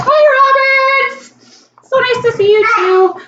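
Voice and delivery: very high-pitched, delighted hello; excited greeting. Real, not acted. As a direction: sing out a welcome to a friend. Greets viewer joining the live stream.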